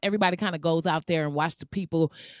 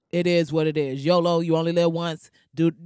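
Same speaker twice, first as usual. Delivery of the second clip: ordinary talk, nothing marked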